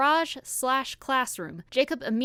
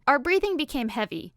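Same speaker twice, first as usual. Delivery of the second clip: ordinary delivery, nothing marked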